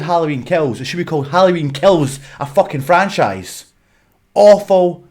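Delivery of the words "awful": loud disgust. Real, not acted. Ranting about Halloween Kills.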